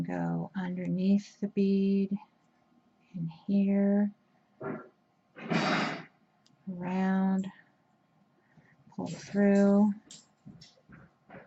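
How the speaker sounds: slow, softened step-by-step instruction; talking slow. Real, not acted. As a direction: talk slowly, pausing as your hands work. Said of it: "I'm talking slow 'cause I'm moving slow".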